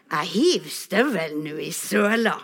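fuller-voiced taunt; bolder teasing threat. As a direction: push the threat at your friend. Threat repeated, escalating. (tydelig, ertende trussel)

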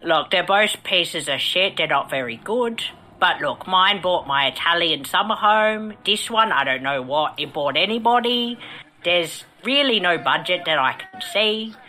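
put-on London accent; mock Cockney actor, grumbling; Michael Caine. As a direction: play a grumpy veteran English film star. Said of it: "Sir Michael Caine".

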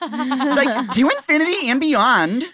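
put-on high, laughing valley girl; valley girl quote. Movie quote done as a high valley girl. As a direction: squeal it like a giggly valley girl. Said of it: "famous movie quotes as a valley girl".

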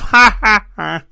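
deliberate, spelled-out fake laughing. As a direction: imitate someone's put-on laugh in separate ha's. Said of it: "you do the fake laugh".

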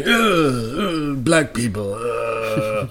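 muttering Queen. Acted. Queen muttering to Philip, mock disgusted grumble. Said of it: "muttering to Philip".

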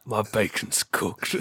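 very slow, soft dying line; wounded soldier gasping. Acted. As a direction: fade out with a last breath. Dying pig's last words, acted.

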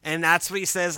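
ordinary delivery

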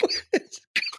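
high squealing burst of laughter; shrieking laugh. Real, not acted. Host cracking up at the co-host's joke, high squeal. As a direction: let out a squeal of real laughter.

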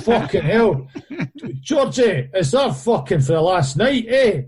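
high-pitched calling-out voice; mock shout from a bloke in the toilets; imitated cinema loudmouth. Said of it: "this boy goes".

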